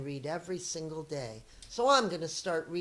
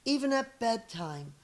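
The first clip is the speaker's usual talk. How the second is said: lively read-aloud storyteller voice; story narration; 6 dB louder than usual. Acted. Teacher reading a picture book to her students, lively storytelling. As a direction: read it to young kids, expressive and clear.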